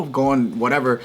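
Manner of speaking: ordinary talk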